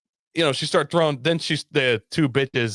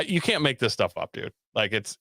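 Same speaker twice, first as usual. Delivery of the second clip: usual speaking voice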